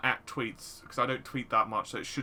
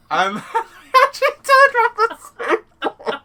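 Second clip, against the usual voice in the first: crying with laughter, high and loud; words squeezed out through laughter; laughing hard; 18 dB louder than usual. Real, not acted. Laughing hard at absurd news.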